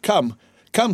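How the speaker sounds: rally a fainting father; alarmed young son. Acted.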